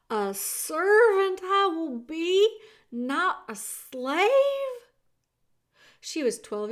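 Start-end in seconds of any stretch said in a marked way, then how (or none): 0.1-4.8 slow, loud, high-pitched marvelling; delighted. Acted. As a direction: savour the news in loud amazement. Meg delighted at her new position.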